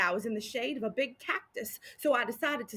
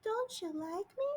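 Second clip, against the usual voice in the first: higher, softer, near tears; tearful little girl; 7 semitones higher, 8 dB quieter than usual. A narrator's reading. Orphan girl near tears asks the sheriff, higher and softer. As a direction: ask it like a hurt little girl. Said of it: "she didn't start to cry".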